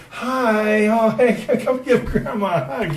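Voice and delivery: high, sugary grandmother greeting with a laugh; sweet grandma voice. Retold or imitated. Grandma turning from the fight to greet her grandson, high and sweet. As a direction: switch to syrupy-sweet granny mid-fight. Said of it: "she turns around to me and she's like".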